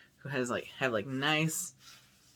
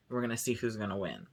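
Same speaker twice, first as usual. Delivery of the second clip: usual speaking voice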